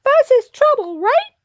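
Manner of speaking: very high voice, rising to a question; squeaky little hero. Acted. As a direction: seek agreement in a tiny voice. Pip speaks in squeaky voice.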